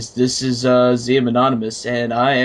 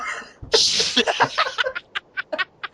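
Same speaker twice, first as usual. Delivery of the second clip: high giggle; 4 dB quieter than usual. Real, not acted. Laughing at own joke.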